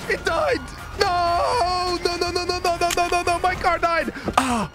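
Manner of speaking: ordinary talk, nothing marked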